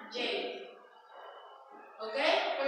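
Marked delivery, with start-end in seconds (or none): 0.1-0.2 "J" said the English way, as "jay"; English letter name. Shown as a demonstration. Teacher showing pupils the English letter, "J" as "jay". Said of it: "La J se pronuncia".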